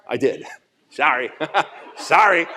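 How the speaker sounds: real laughter breaking through sorry; laughing apology. Real, not acted. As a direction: giggle through the apology, almost losing it.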